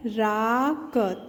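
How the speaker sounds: syllable by syllable, slow; sounding out words. Shown as a demonstration. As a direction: sound out each syllable slowly. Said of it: "त में ए की मात्रा तै".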